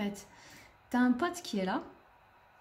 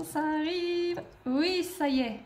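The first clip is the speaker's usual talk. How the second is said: excited; 6 semitones higher, slower than usual. Real, not acted. Death finally arriving on screen.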